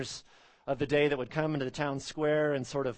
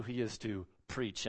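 slight pause, then weight on "preach"; stress on preach; 4 semitones lower, 6 dB quieter than usual. Shown as a demonstration. Pastor stating the second point to the church, stress on "preach". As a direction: lean deliberately on the word "preach". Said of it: "emphasis on the word preach".

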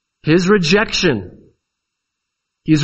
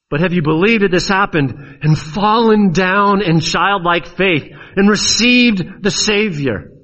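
raised pitch, driving, insistent appeal; impassioned preaching; 6 semitones higher than usual. Real, not acted. Preacher urging congregation to come to Christ, fervent and insistent. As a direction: press each question home with rising fervour. Preacher presses the altar call.

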